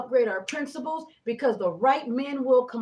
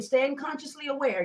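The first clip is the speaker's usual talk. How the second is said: ordinary talk, nothing marked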